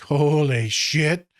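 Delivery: slow, drawn-out astonishment; stunned reaction. Retold or imitated. Reacting to a client's pregnancy text, stunned. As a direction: stretch it out in mock shock.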